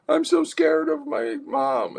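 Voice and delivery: mock scared child, very high; anxious child. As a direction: whimper slowly for protection, acted. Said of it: "the child is signaling is anxiety".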